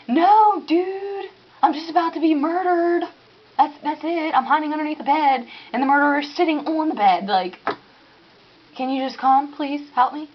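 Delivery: mock-panicked plea; frantic plea. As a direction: beg for help while hiding. Acted panic under bed.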